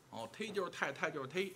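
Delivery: usual speaking voice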